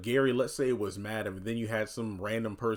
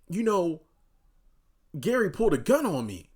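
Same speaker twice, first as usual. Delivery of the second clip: higher, louder mock outrage; pitched-up imitation of complaining; imitated complaint; 8 semitones higher, 6 dB louder than usual. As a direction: retell it as an aggrieved tattletale. Voices Zach complaining to Fatima.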